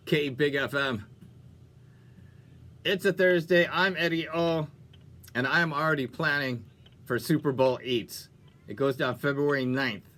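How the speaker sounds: ordinary talk, nothing marked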